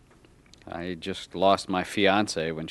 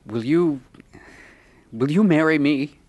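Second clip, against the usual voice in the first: halting, higher and louder proposal; nervously eager, voice lifted; 6 semitones higher, 4 dB louder than usual. Acted. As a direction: pop the question, stumbling into it. Man proposes marriage.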